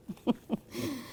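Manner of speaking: soft, brief chuckle; short amused laugh. Real, not acted. Writer breaks off reading her passage, soft amused laugh. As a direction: chuckle softly at your own line.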